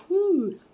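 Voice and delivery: sharp high whew through burning lips; hot-mouth whew. Real, not acted. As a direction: blow out the heat. Exhale after spicy bite.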